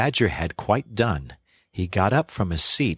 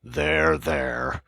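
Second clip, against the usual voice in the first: kindly; 3 semitones lower than usual. A narrator's reading. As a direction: comfort a sobbing child. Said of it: "went on the Badger more kindly".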